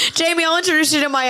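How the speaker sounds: fast, high laughing tease. Real, not acted. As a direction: rush it out while laughing. Laughing while teasing.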